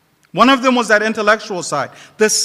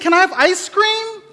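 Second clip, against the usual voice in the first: put-on child voice; 9 semitones higher than usual. A narrator's reading.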